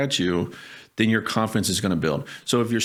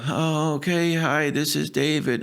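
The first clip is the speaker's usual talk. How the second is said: weak unsure intro; 4 semitones higher than usual. Shown as a demonstration. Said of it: "I'm gonna hang up on that person".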